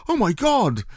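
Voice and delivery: high, startled cry at the cold wind; shocked cry. Retold or imitated. Listener caught in a t-shirt by the wind, high shocked cry. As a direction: yelp as the chill hits you. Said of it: "and you're gonna go".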